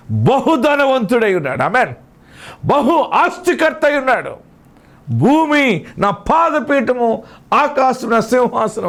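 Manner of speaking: very high, ringing proclamation; slow, emphatic preacher's cry; impassioned preaching. Acted. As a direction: declare God's majesty with soaring pitch. Preacher proclaims God's greatness.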